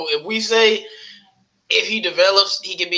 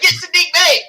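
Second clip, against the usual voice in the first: high, loud retold shout; mock yell, much higher and louder; mad demand; 13 semitones higher, 6 dB louder than usual. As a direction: bark the pick at the team. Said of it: "We were mad as hell".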